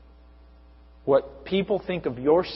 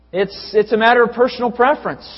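higher and louder, mocking the claim; mock relativist quote; 7 semitones higher, 6 dB louder than usual. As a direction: parrot the shrugging view. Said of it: "they say".

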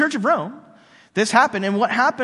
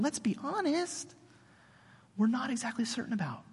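soft aside; 5 semitones higher, 10 dB quieter than usual. Real, not acted.

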